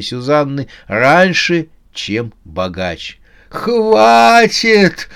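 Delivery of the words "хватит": loud, high, drawn-out yell. A narrator's reading. Beaten old man begging Susanna to stop, yelling. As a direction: yell it out high, stretching the word. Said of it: "заорал старик".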